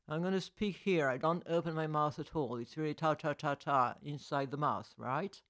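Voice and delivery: lightly put-on British accent, mouth barely open; a mild British English impression, lips still. Coach imitates how the British speak, mild British accent. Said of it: "Si je parle anglais, british".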